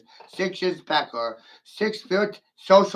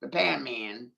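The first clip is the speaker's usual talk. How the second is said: ordinary delivery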